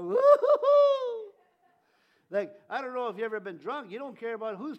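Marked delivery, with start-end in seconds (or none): 0.0-1.3 high, loud drawn-out woo-hoo; joyful whoop. Retold or imitated. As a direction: whoop like someone lost in the Spirit. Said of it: "You go".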